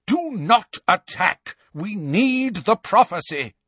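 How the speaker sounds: high, loud, slowed command; urgent order. A narrator's reading. Malfoy ordering Death Eaters, high loud command. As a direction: hold them back, forcefully.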